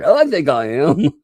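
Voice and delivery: high, loud put-on protest; mock insistence. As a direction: insist like a miffed wannabe. Repeats the mocked insistence.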